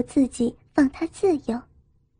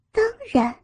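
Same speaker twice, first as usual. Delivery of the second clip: high-pitched haughty reply; proud and lofty, pitched up; 8 semitones higher than usual. A narrator's reading. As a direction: toss it off with an air of superiority. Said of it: "高傲地仰高下巴".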